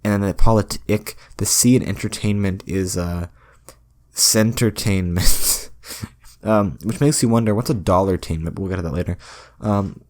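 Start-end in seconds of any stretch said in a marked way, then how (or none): 4.1-5.7 "centertainment" said very slowly, syllable by syllable; drawn-out made-up word. Shown as a demonstration. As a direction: stretch out "centertainment" like a careful reveal. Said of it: "sounding out made-up word".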